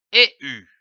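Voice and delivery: usual speaking voice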